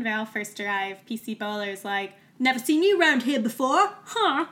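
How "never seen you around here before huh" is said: hammed-up British copper, higher and louder; mock suspicious village policeman in a bad British accent; terrible British accent. Podcaster imitating the village constable questioning newcomers, hammed-up British accent. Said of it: "my terrible British accent".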